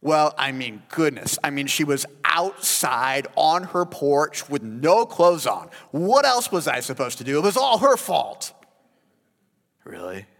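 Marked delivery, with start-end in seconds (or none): 0.0-8.5 louder, higher whiny excuse-making. Pretend. David excusing himself, louder whiny blame-shifting. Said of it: "the excuse playbook".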